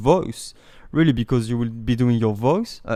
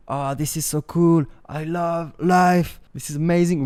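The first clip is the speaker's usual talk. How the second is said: bright enthusiastic lift on cool, life and amazing; cheerful self-talk with real intonation; good intonation; 4 semitones higher than usual. Shown as a demonstration. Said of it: "with good intonation".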